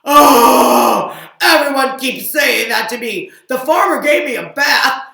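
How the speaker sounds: loud high exasperated shout; loud high whiny rant; frustrated cow. Acted. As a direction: vent in frustration.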